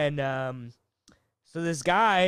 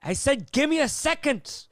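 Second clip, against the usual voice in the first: higher, irritated snap at the speaker box; snapping back, a bit higher; mock angry snap; 9 semitones higher than usual. Rude customer snapping at the drive-thru lady, mock irritated. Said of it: "And he goes".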